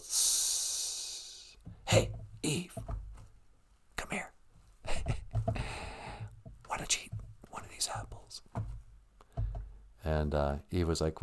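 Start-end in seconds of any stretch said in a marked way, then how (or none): 0.0-8.4 hissing, whispered tempter; sneaky snake whisper. Acted. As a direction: whisper like a sly serpent. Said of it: "he goes".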